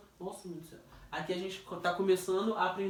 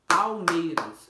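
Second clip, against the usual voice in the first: "Palmeiras" slow and very loud, in beats; syllables beaten out; 9 dB louder, much slower than usual. Shown as a demonstration. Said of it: "me ensinou a bater palma". (Palmeiras forte e pausado, sílaba por sílaba)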